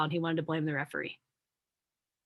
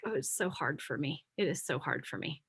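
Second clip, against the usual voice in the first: soft, whiny put-on self-pity; mock self-pity; 4 semitones lower, 9 dB quieter than usual. Speaker mocking her old victim self, soft whiny self-pity. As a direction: sigh it out like a martyr fishing for sympathy. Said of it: "that badge of, like".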